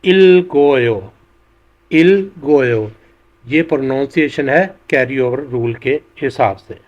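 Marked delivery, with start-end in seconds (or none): 0.0-2.9 इलगोयो drawn out slowly, the consonant carried into the next syllable; slow linked pronunciation. Shown as a demonstration. Teacher modelling a Korean word slowly for learners. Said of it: "और ये लफ्ज़ pronounce होगा".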